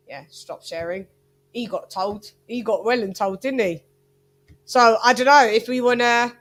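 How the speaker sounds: ordinary talk, nothing marked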